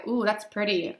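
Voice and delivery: ordinary delivery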